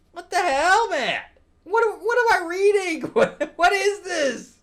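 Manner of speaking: very high-pitched, incredulous outburst; real flabbergasted ranting; exasperated disbelief. Real, not acted. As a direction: squeak out real bafflement at what you just read. Baffled outburst at comic's ending.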